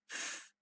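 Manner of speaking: short F, only air, no voice; unvoiced f sound. Shown as a demonstration. Teacher has students check their voice box, unvoiced F. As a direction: blow a soft voiceless F. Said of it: "The f sound is unvoiced".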